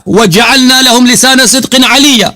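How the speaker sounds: high, insistent Arabic recitation; Quran recitation. Shown as a demonstration. Debater rereading the verse over his opponent, high recitation. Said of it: "میں نے دو تین دفعہ پڑھی ہے".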